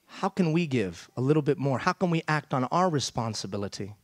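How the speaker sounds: ordinary delivery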